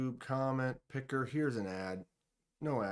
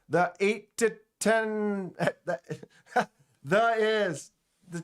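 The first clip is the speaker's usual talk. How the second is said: high, loud, breaking into real laughter; laughing stumble; 6 semitones higher, 5 dB louder than usual. Real, not acted. Streamer stumbling over a viewer's name, real laughter. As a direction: trip over the words, laughing.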